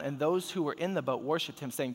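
ordinary talk

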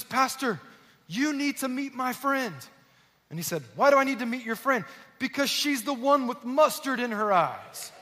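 a woman bursting with news for the pastor; a woman rushes up to tell the pastor, excitedly. Retold or imitated. Excited woman voiced in story.

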